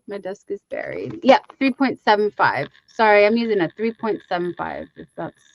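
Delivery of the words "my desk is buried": "My desk is buried" muttered much softer; quiet repeat. Real, not acted. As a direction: drop "My desk is buried" to under the breath.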